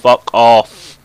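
loud furious yelling at a teammate; angry rant. Real, not acted. Gamer yelling at idle teammate Mapsody, loud and furious. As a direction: rage at him for sitting still.

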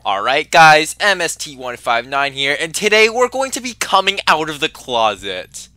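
high, loud hammed-up intro voice; mock YouTuber intro. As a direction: announce it like an intro.

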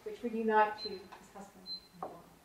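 measured, formal officiant delivery; formal ceremony speech. Acted. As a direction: read the civil ceremony with solemn formality. Registrar leads wedding ceremony.

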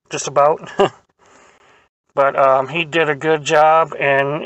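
speaking between bites; talking while chewing. Real, not acted. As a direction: mouth partly full. Seen 2: Cheeks bulge, jaw working, lips pursed throughout; waffle itself not visible.

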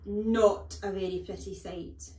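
loud, slow disdain; the headmistress's drawn-out disgust; utter distaste. A narrator's reading. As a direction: pronounce it slowly with distaste. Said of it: "one of utter distaste".

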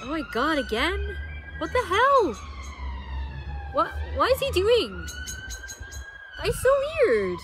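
high, loud disbelieving exclamations; really startled, voice jumping up; shocked surprise. Real, not acted. Shocked at police van on road.

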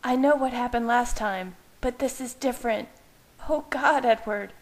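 anguished cry breaking out; wailing. Acted. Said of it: "she wailed".

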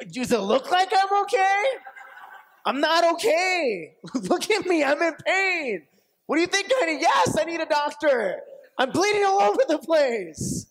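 high, loud exasperated outburst; hammed-up shouting in pain; upset yelling. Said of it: "you get so upset".

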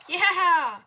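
loud laughing yeah; excited cheer. Real, not acted. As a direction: whoop it out. Cheer closing the video.

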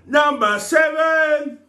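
high, slow drawn-out announcement; game show announcer. Acted. Reading the next question, he announces it like a host. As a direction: stretch it out like a quiz host.

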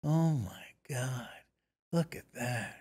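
soft, breathy near-whisper of amazement; a producer's hushed wonder at the makeup; hushed awe. Retold or imitated. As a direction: marvel at it under your breath. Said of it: "he goes like this".

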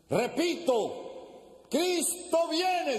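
high, long-drawn preacher's cry; street preacher. Acted. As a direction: proclaim it slowly across the park. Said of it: "a repetir la frase".

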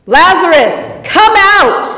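high, ringing loud call; loud cry. Acted. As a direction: call the dead man out of the cave. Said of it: "he cried out really loudly".

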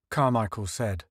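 usual speaking voice

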